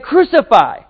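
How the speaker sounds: loud, high mock crowd shout; crowd's demand. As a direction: call it out like a crowd demanding a sentence. Crowd demanding crucifixion acted out.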